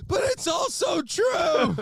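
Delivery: exasperated shout. Real, not acted.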